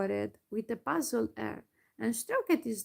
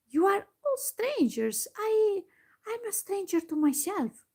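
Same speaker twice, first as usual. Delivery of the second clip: bewildered; 9 semitones higher than usual. Acted. Lost gnome confesses.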